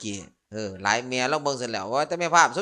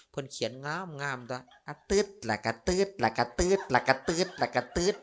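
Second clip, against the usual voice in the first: drawing-stroke sound effect; 3 semitones higher than usual. Retold or imitated. Monk mimicking eyebrow pencil strokes for the crowd, playful sing-song.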